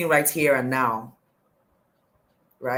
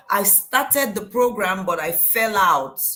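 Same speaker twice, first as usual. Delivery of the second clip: usual speaking voice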